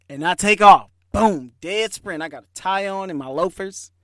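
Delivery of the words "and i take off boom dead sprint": sudden loud burst. Retold or imitated. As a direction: explode into the sprint. Second run, bursting out.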